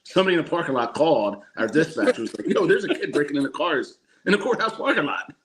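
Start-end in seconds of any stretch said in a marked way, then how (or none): 2.5-5.3 mock alarm, rising higher toward the end; retold urgent report. Caller reporting a car break-in to dispatch, mock urgent. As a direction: imitate an incredulous caller, pitch climbing high.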